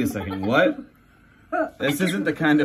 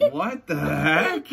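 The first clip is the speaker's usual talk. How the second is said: plain reading voice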